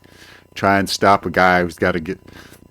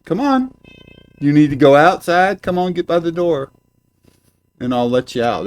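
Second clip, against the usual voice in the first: high, coaxing pet-owner voice; sing-song call to a pet; talking to the cat; 9 semitones higher, 4 dB louder than usual. Real, not acted. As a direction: coax the cat toward the door.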